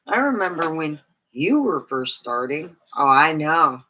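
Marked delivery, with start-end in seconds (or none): none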